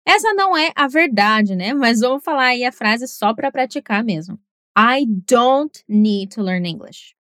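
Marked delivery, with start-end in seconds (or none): 4.8-7.1 slow, "don't" punched hard; stress on don't. Shown as a demonstration. Teacher practising an emphatic negative, stressing don't. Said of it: "reforçar também a palavra negativa".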